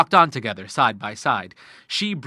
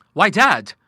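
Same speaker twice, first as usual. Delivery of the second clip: glad call; 6 semitones higher, 8 dB louder than usual. A narrator's reading. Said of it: "he cried".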